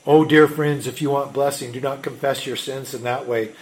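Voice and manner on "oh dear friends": normal talking voice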